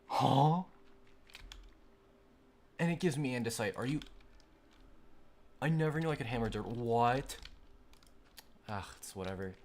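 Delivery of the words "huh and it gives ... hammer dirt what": loud, high astonished exclamations; amazed disbelief. Real, not acted. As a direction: gasp it out like you can't believe it. Amazed at hammering dirt.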